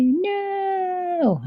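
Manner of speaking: put-on high kid's voice calling out no; kids answering. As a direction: answer like a classroom of little kids. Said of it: "And they would say".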